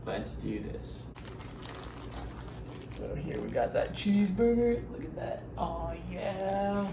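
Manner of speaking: breathy close-mic ASMR tone. Acted. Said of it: "Is this A S M R?".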